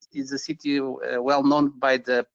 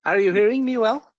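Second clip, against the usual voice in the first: surprised; 6 semitones higher, 4 dB louder than usual. Real, not acted. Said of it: "you seem surprised".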